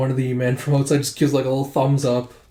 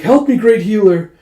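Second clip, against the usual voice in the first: panicked plea; 9 semitones higher, 9 dB louder than usual. Acted. Panicking farm hand calls for help.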